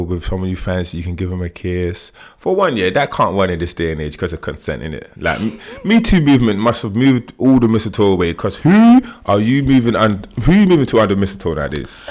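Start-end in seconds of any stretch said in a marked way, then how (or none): none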